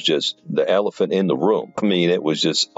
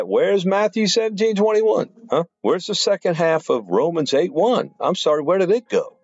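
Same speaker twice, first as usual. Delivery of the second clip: mocking falsetto; 13 semitones higher than usual. Mocking questions at the altered Bibles.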